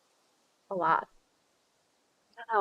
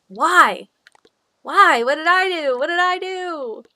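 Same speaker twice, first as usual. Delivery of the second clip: loud, high theatrical lament; mock wailing; 8 semitones higher, 9 dB louder than usual. Streamer bemoaning warm Christmas weather to the heavens, mock wailing. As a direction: cry out in hammed-up despair.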